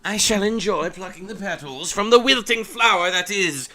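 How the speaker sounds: loud cartoon villain taunts and brave replies; hammed-up game dialogue. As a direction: ham up the boss-fight showdown. Hams up game dialogue voices.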